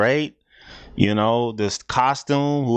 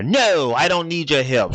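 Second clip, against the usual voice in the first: raised-pitch mock stubbornness; retold refusal, pitched up on the "No"; stubborn refusal; 4 semitones higher than usual. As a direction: imitate a stubborn woman turning down help. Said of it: "She's so stubborn".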